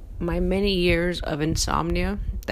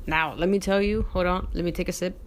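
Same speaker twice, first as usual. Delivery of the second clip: ordinary talk, nothing marked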